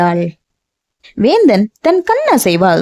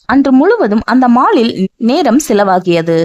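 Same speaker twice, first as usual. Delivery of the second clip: plain reading voice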